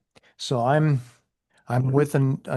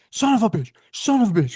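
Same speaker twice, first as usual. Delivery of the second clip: high mock yell of the partner; imitated screaming; 8 semitones higher, 4 dB louder than usual. Partner scooting away from the car, mock screaming. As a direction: cry it out in acted panic. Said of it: "we're both screaming".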